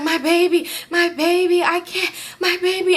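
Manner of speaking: high, anguished mock cry; panicked high-pitched pleading; imitated distressed wail. Pregnant woman after the crash, imitated distress.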